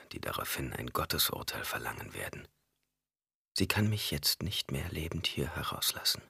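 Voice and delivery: low, hushed inner voice; inner thought. A narrator's reading. Jessica thinks to herself about Alia, hushed. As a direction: think it to yourself. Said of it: "sagte Jessica und dachte".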